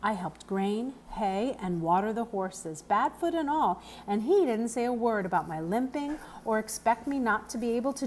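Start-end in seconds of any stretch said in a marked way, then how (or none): none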